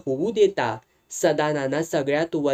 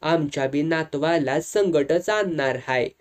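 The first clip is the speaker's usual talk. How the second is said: ordinary delivery, nothing marked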